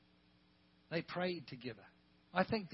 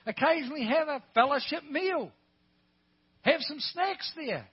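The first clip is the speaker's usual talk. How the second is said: raised, higher-pitched pulpit voice; exhorting preaching; 7 semitones higher, 8 dB louder than usual. Real, not acted. Preacher encouraging home groups to eat together, exhorting preaching. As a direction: urge the congregation on with lifted voice.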